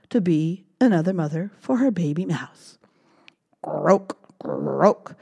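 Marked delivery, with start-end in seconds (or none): 3.6-5.1 frog croak. Acted. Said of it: "said Missus Frog".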